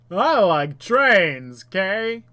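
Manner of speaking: loud, drawn-out silly voice; put-on goofy chat voice. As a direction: stretch it out loudly, acting a player. Reads player chat in put-on voice.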